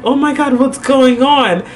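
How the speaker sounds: high, worried reaction; mock-alarmed at a crying caller; retold alarm.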